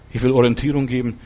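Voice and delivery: usual speaking voice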